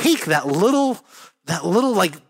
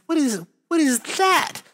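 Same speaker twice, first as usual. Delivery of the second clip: startled disbelief; 11 semitones higher than usual. Retold or imitated. Startled on noticing something odd.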